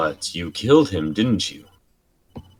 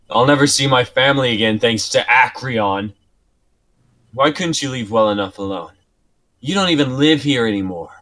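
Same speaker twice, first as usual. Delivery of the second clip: higher, louder, fast blaming; angry accusation; 6 semitones higher, 5 dB louder, faster than usual. Acted. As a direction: spit the blame at him. Prisoner blaming Acreon.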